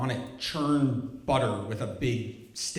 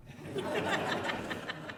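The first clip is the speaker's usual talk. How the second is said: high laugh; 8 dB quieter than usual. Real, not acted.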